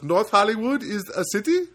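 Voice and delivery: put-on high, loud Indian accent, climbing at the end; questioning Indian accent. Agent querying the caller's address, questioning Indian accent. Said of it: "And he goes".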